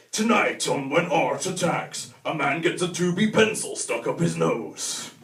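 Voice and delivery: slow, booming announcer read; documentary trailer voice. Pretend. Mock documentary trailer narration.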